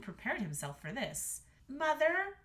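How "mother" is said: high, raised daughter voice; earnest Astrid. A narrator's reading. Daughter appealing to the queen, earnest. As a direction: appeal to mother, pitched up. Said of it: "said Astrid".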